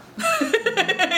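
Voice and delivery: sudden high laugh; amused laugh; high burst of laughter. Real, not acted. Laughs at his own question.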